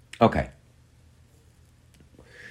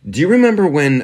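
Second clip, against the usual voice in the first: high and loud, grabbing attention; a loud, rising start; loud emphatic; 10 semitones higher, 8 dB louder, faster than usual. Real, not acted. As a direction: hit it loud and high to pull them in.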